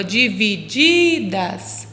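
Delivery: divididas very slowly, second di held long; stress on penultimate syllable. Shown as a demonstration. Teacher checks if it's the last syllable, divididas drawn out. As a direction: draw out divididas, stressing the second di before das. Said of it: "mas ela é a última sílaba".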